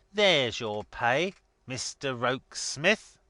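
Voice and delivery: lightly voiced gruff master, emphatic and slow; a touch of contemptuous emphasis; scornful Boffin. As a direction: toss it out with scorn, slowly.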